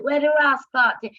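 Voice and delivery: loud, high outburst mimicking dad; dad's blunt voice. Daughter imitating her dad's rough lingo, loud and high. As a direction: blurt it out like her blunt father. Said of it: "And my dad said".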